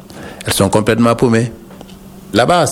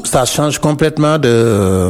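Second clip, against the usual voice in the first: ordinary talk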